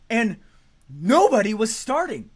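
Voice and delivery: ordinary talk, nothing marked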